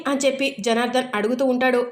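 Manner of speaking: usual speaking voice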